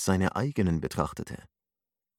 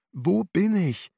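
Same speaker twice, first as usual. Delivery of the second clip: much higher, slow, tormented cry; tormented, high and drawn out; anguished question; 12 semitones higher, 3 dB louder, slower than usual. A narrator's reading. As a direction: ask it in slow torment, pitched up. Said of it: "Er wurde von Fragen gequält".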